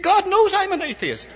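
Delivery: high, loud, laughing punchline; laughing retort. Retold or imitated. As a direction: toss it off with a big laugh. Khrushchev's quoted punchline, laughing.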